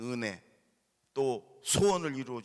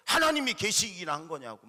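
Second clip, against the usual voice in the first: angry son; 5 semitones higher, 6 dB louder than usual. Retold or imitated. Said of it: "막 이러는 거예요".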